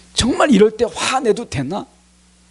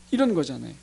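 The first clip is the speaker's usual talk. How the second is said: ordinary delivery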